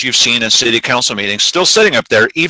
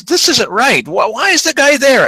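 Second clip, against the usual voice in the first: higher, indignant challenge; indignant objection; 9 semitones higher than usual. Retold or imitated. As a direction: demand an answer from the council, pitch up. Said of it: "and he says".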